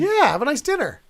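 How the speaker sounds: high, loud burst of acted enthusiasm; eager agreement. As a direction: jump on the idea to win them over. Guard eggs others on to skive.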